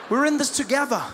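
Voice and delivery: normal talking voice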